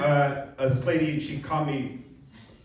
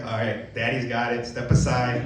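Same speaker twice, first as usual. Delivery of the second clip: mock macho; 6 semitones lower than usual. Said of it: "something happens in my brain".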